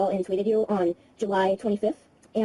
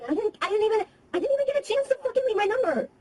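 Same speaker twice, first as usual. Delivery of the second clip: high, exasperated frustration; frustrated; 10 semitones higher than usual. Real, not acted. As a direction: voice climbing in frustration. Upset at failed voicemail.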